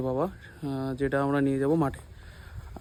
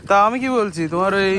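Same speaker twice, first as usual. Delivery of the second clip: loud, raised voice outdoors; shouting over machinery; 5 semitones higher, 9 dB louder than usual. Real, not acted. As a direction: shout over the engine noise. Talking over field machinery.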